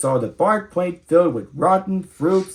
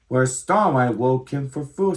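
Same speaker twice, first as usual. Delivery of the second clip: ordinary delivery, nothing marked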